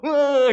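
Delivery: high, loud hammed-up battle cry; loud mock fighting yell. Preacher acting a fight escalating, loud mock yell. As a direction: roar it out like a kid striking back. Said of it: "이래".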